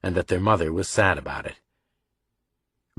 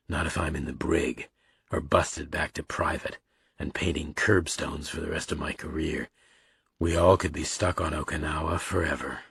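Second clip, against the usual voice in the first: softer, subdued fatherly voice; gloomy father; 3 semitones lower, 7 dB quieter than usual. A narrator's reading. Father warns sons about his ruined career, gloomy. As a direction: say it quietly, with resignation.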